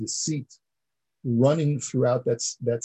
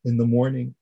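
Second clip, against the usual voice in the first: usual speaking voice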